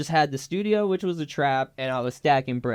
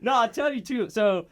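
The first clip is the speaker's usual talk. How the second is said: real laugh breaking through the words, higher and louder; 8 semitones higher, 5 dB louder than usual. Real, not acted. Telling the host he kept the weed, laughing hard. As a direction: talk through the giggles. Cracking up at own story.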